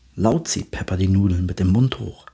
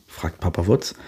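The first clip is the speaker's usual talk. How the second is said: usual speaking voice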